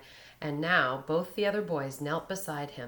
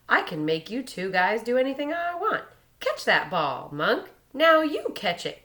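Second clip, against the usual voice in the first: gleeful taunting; 7 semitones higher, 7 dB louder than usual. A narrator's reading. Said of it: "Sko replied joyfully".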